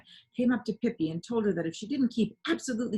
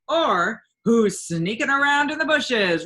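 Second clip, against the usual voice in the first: loud, higher, slow barker cry; barker shouting; 5 semitones higher, 10 dB louder, slower than usual. A narrator's reading. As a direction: call it out to draw the crowd. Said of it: "a man was shouting".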